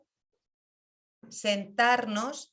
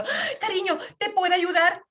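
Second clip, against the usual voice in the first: theatrical fake sympathy, pitched high; overdramatized caring; 8 semitones higher than usual. Shown as a demonstration. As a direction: ham up the rescuing mother. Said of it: "Dramatizo para que se me vea".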